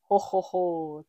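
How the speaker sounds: low, pleased ho ho ho; a daddy's satisfied chuckle; Daddy Pig pleased the tail fits, chuckling. A narrator's reading. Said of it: "chuckles Daddy Pig".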